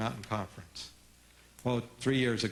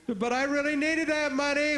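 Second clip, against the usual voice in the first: put-on whiny kid; 11 semitones higher, 3 dB louder than usual. Kid whining at parent.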